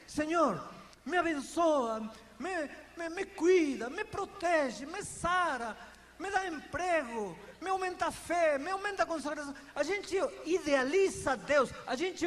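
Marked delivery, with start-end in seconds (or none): 0.1-9.5 higher, insistent string of requests; pleading list. Retold or imitated. Pastor mimics believers piling requests on God. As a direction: rattle off demands to God. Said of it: "A gente às vezes diz".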